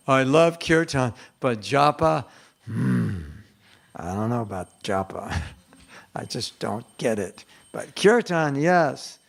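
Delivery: mock reluctance, hemming over japa; reluctant devotee. Voicing a chanter who just doesn't get japa, reluctant.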